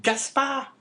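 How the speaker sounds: high, loud call in a woman's voice; crying out. A narrator's reading. Wife warning her husband, high cry. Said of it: "cried La Carconte".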